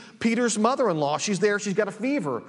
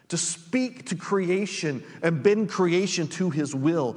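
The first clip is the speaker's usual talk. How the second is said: usual speaking voice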